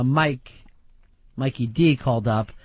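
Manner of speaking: ordinary delivery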